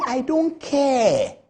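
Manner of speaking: slow, drawn-out mock indifference; mocking dismissal. Mocking Sam George's Facebook reply, slow dismissive drawl.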